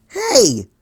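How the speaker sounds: sharp loud call across the room; shout. A narrator's reading. Dash shouting at Twilight, loud call. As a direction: yell it out, startled. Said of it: "shouted Dash".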